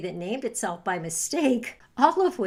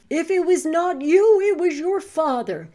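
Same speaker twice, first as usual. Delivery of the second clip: loud, higher wolf snarl; 7 semitones higher, 9 dB louder than usual. A narrator's reading. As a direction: snap it out before pouncing. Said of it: "snarled the wolf".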